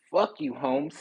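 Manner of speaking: ordinary talk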